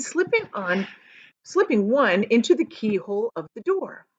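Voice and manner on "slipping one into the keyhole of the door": usual speaking voice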